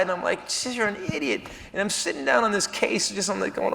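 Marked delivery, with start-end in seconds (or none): 0.6-1.5 much softer self-scolding; muttering to self. Retold or imitated. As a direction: hiss it at yourself, very soft. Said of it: "I pull myself to the side".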